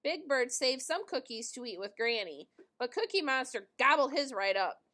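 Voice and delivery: ordinary delivery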